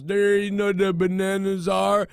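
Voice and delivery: slow, put-on voice of a man in the supermarket; lightly mock the guy asking about bananas. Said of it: "he goes".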